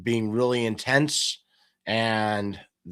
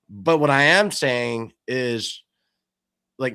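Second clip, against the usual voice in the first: ordinary talk, nothing marked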